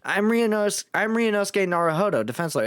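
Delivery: high, hesitant lawyer voice; nervous young lawyer. Acted. Defense lawyer introducing himself to the governor, nervous and hesitant.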